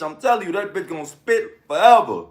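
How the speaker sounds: ordinary delivery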